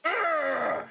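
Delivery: a loud, high, furious Urgh; frustrated growl. Acted. Grieving sibling boils over at the killer, angry growl. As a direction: snarl out your fury.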